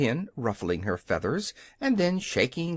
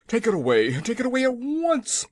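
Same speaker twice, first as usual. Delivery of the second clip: loud, higher panicked shout; 10 semitones higher, 5 dB louder than usual. A narrator's reading. The frightened King shouts at the hen, panicked shout. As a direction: yell it to get the egg gone. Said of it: "he shouted".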